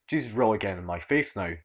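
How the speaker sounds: ordinary delivery, nothing marked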